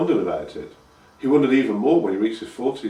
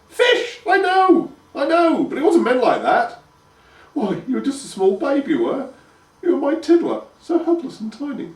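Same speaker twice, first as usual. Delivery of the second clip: high, loud mother voice, fond; nostalgic mother; 5 dB louder than usual. A narrator's reading. Mother reminiscing to her grown son. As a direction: gush about him as a baby.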